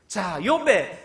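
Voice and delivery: normal talking voice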